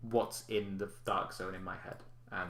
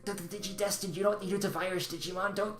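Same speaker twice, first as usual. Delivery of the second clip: loud shush, then high scolding creature voice; 10 semitones higher, 6 dB louder than usual. Acted. Little creature scolds its friend for yelling to strangers, high and urgent. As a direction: frantically hush a careless friend. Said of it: "another one just goes".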